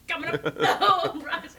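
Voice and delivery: high mole voice breaking into laughter; imitated storybook mole. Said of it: "Exactly how he said it".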